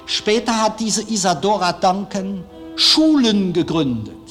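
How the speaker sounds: usual speaking voice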